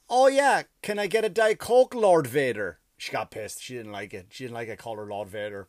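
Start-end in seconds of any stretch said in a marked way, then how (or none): 0.1-2.8 loud, high, cheeky jab at a masked server; cheeky mock request. Comedian to the masked server, mocking her loudly. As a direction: call her Lord Vader with loud sarcastic glee. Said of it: "And I went".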